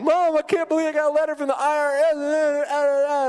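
high-pitched whiny complaint to mom; whining. Retold or imitated. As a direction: whine it like a panicked young man. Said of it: "And I'm just whining".

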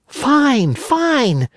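high, joyful shout of glee; joyful cry. Acted. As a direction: burst out with joy, dancing. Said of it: "cried Pinocchio, dancing about with joy".

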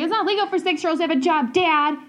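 high, rapid, bratty comeback; indignant child. Retold or imitated. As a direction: rattle it off like a kid schooling Dad. Child arguing with dad, retold.

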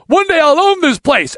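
high, loud vow yelled at the owner; retold defiant shout. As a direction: shout the promise as you get thrown out. Yelling at the gym owner.

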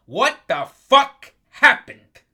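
loud, slow, disbelieving yell; exasperated shout. Real, not acted. Fed-up host yelling at the Falcons' collapse, slow loud shout. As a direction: bellow it out slowly in disbelief.

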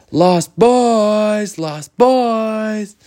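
high, drawn-out calling shout; yelling. Acted. Children calling to the Lost Boys' snowed-in house, high loud yell. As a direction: holler for friends hidden far away. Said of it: "so they just yelled".

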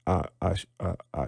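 soft, halting false starts; struggling reader. Retold or imitated. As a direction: imitate a student stumbling over a word. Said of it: "you know, they struggle".

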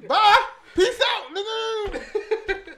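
mock dismissive send-off. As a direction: shoo the delivery man off in jest. Acting out sending off delivery man.

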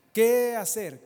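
loud, high cry of qué hacer; shouted question. Real, not acted. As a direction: call out qué hacer to the church. Repeated question.

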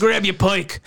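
high, broad mock Australian vowels; hammed-up Australian ad caricature; fake Australian accent. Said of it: "fake Australian accent from Outback Steakhouse commercials".

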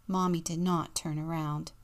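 ordinary delivery, nothing marked

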